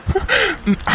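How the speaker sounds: short, high, affectionate chuckle; fond laugh. Acted. Husband laughs fondly.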